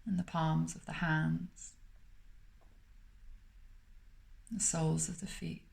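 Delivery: calm, unhurried meditation voice with long pauses between phrases; guided meditation. Acted. Meditation teacher guiding listeners to smile through the body, calm and spacious.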